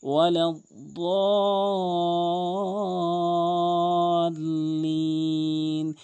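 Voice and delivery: chanted ওয়ালাদ্দাল্লিন with very long দা; very slow ওয়ালাদ্দাল্লিন; four-alif stretch. Shown as a demonstration. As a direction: hold the heavy দা in ওয়ালাদ্দাল্লিন four alifs. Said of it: "চার আলিফ টেনে পড়বেন".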